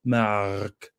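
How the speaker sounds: low, drawn-out French-accented "Mark". Shown as a demonstration. Practising a French accent on Mark's name, French accent. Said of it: "trying to get my French accent back".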